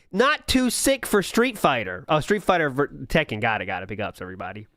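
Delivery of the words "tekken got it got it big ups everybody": ordinary delivery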